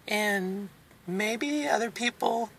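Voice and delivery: usual speaking voice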